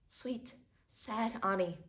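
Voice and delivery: soft, slow and sorrowful; tragic heroine sorrow. Acted. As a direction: linger on her name with grief. Tragic monologue, tender address.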